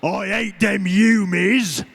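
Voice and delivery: loud, drawn-out Cockney orc roar. Shown as a demonstration. Actor demonstrating his human-hating orc for the audience, Cockney orc bellow. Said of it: "he was regularly saying".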